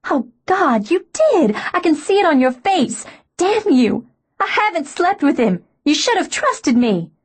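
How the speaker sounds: hurt fury breaking out; furious outburst. A narrator's reading. Outburst after his admission.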